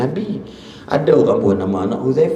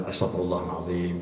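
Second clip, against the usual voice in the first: quiet istighfar; 6 semitones lower, 11 dB quieter than usual. Shown as a demonstration. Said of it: "Cepat-cepat istighfar".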